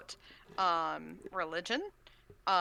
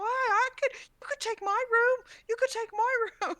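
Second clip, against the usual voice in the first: very high, loud eager crew voices, laughing at the end; squeaky, overeager sailors; eager dwarves; 8 dB louder than usual. Acted. Other dwarves offer their rooms.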